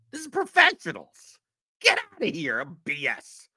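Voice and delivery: high, loud mocking scoff. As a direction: scoff at it like it's absurd. Mocking outrage at baseball rules.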